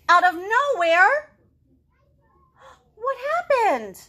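high, loud dramatic build; high excited storyteller question; suspense. A narrator's reading. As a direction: ramp up the suspense for kids. Suspense build in read-aloud.

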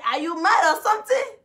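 high mocking catchphrase, lightly loud. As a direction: toss out the catchphrase. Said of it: "our bros [name] say".

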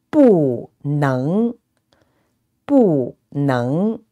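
"不" falls, then "能" rises; fourth-tone bu. Shown as a demonstration. Teacher giving a phrase to repeat, "不" falling, "能" rising. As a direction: drop "不", then lift "能" from low. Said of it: "bu, with the fourth tone".

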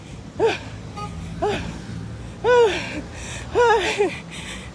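long, high exhausted sighs; heavy panting. Real, not acted. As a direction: puff hard from walking. Struggling on a long walk.

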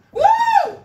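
shrill cheering whoop; high whoop. Real, not acted. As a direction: whoop to pump up the class. Cheering through the final round.

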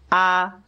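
high level "Ah", held steady without wavering; first tone. Shown as a demonstration. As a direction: sing "Ah" as a clean high note. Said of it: "the first tone is".

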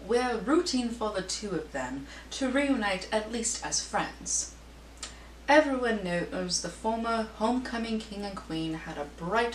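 put-on British accent, lilting gossip-columnist read; Lady Whistledown accent. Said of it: "I had to read that in an accent".

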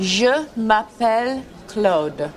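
"Je m'appelle Claude" drawn out very slowly; very slow demo. Shown as a demonstration. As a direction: stretch out every sound of "Je m'appelle Claude". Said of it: "Really listen".

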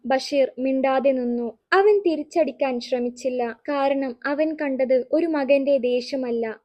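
ordinary delivery, nothing marked

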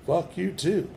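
angry retort. Real, not acted. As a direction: toss the curse back sharply. Cursing back at spirit.

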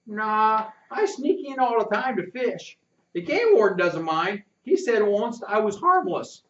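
put-on high boyish country voice, eager; a sly kid boasting what he saw; country boy Rufus. Rufus tells the narrator what he saw, boyish and high.

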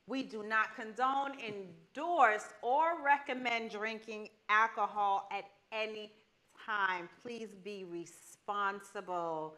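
soft, slow formal disclaimer read. Acted. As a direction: read it like fine print. Reading legal disclaimer.

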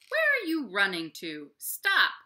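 loud, stern bear command; frowning bear. Acted. Big brown bear frowning at the animals, loud and stern. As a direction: bark it like a cross bear. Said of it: "says the big brown bear with a frown".

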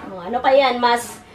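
ordinary talk, nothing marked

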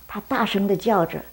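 usual speaking voice

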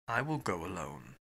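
very low, soft, cold resolve. A narrator's reading. Fogg to the captain, resolved to go, quietly cold. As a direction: state it coldly and quietly. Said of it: "said Mister Fogg coldly".

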